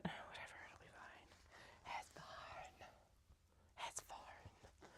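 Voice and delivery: hushed, fading reassurance to self; whispering to herself. Real, not acted. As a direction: whisper it away, letting it go. Shrugging off a warbly seam.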